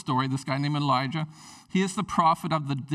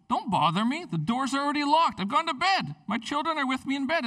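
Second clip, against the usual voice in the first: irritated, pitched up, faster at the end; annoyed refusal; 8 semitones higher than usual. Acted. Sleepy friend refusing the knocker at midnight, annoyed. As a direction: grumble the no from under the covers.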